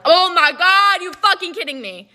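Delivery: high, loud acted outburst of alarm; panicked disbelief. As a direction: replay the panic, pitched well up. Reacting to dad's alarming text.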